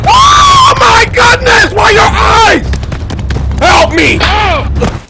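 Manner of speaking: very high, loud terrified screams, first one drawn out slow; real panic while fleeing the monster; terrified screaming. Real, not acted. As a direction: scream for your life.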